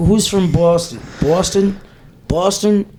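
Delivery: put-on Boston accent. Comedian checking how Bostonians say it, tentative Boston accent. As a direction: test the Boston vowels, unsure. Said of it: "how do you do a Boston accent".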